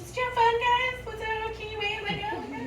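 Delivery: high-pitched eager mother questions; retold motherly quizzing in a high voice; chirpy mom. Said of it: "my mom is, like, asking".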